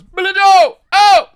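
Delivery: very high, very loud put-on shriek; imitating the movie guy. Mocking the same goon's cry in the movie, high loud shriek. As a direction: shriek the name like a panicked goon. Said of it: "same guy that goes".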